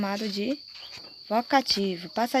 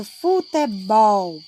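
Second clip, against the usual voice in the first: "futebol" drawn out syllable by syllable, loud on "bol"; stress on BOL; 6 dB louder, much slower than usual. Shown as a demonstration. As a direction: slowly split "futebol", landing hard on its last syllable. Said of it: "Bol é a sílaba forte".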